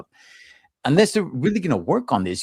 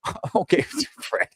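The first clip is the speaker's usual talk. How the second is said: laughing; 11 semitones higher, 3 dB quieter than usual. Real, not acted. Laughing at friend's blue pill remark.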